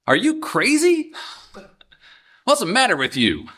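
high, incredulous put-on scold; harsh critic. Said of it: "Those are technically questions".

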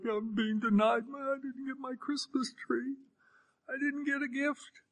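small, high, soft pouting voice; mock a deprived kid's whine; thin, plaintive child's complaint; whining child. Voices a child feeling denied.

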